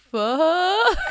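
high, loud startled shout; panicked kid shout; retold yell. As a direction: blurt it out in fright. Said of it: "Och han bara".

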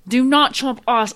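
loud exasperated plea; shouting. Real, not acted. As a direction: yell at him to stop chewing. Annoyed at chewing into mic.

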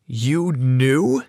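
understated, incredulous question; disbelief. Acted. Sarah confronts Brad about the secret, in disbelief. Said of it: "she said in disbelief".

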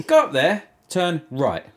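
mock the clueless worker's directions; imitating shop worker.

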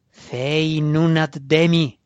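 loud, slow incantation; solemn spell chant, drawn out; slow intoned spell. Acted. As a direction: chant each word out slowly and loudly. Wizard corrects incantation.

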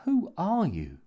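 high-pitched, bewildered plea; helpless. A narrator's reading. Jimmy questions the painted intruder, helpless and bewildered. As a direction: ask it helplessly, voice rising high. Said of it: "said Jimmy, helplessly".

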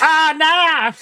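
very high exaggerated Aussie "oh no"; mock Aussie accent. Co-host finally getting it, high mock Aussie accent. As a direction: push the mock Australian up high. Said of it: "so they're going".

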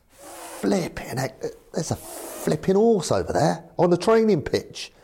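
high-pitched, incredulous outburst; startled exclamation. Retold or imitated. Manager spots a horse on the training pitch, startled exclamation. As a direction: blurt it out in high disbelief.

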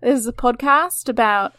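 hammed-up Aussie host welcoming listeners; Australian accent, bright podcast-host bounce. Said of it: "that's the end of the Australian accent".

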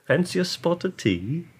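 put-on slow English accent. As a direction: ask it like an English lady. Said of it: "English accent".

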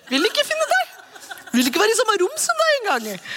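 mock grumpy God. Mocking the stern God picture.

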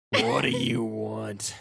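slow put-on pirate voice; slow demand from a chained pirate; hulking pirate. Captive pirate to his interrogator, slow pirate voice. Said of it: "you see this hulking pirate".

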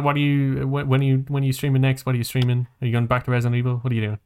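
ordinary talk, nothing marked

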